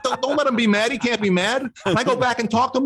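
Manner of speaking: high, anxious plea; pleading. Retold or imitated. To the chief, pleading high and anxious.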